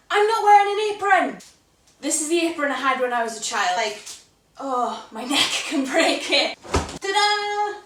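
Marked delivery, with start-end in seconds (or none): 0.1-1.4 higher and louder, alarmed; real surprise; sudden realisation. Real, not acted. 6.7-7.8 high, bright flourish; playful reveal. Real, not acted. Shows off childhood apron.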